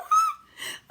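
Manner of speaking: very high, loud excited squeal; thrilled squeal. Acted. May squeals at the assignment, very high loud squeal. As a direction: let out a delighted shriek. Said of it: "May squealed, very thrilled".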